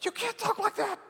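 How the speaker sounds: scolding Peter. Retold or imitated. Peter reprimanding Jesus, high and exasperated. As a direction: scold your friend in disbelief. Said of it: "He's like".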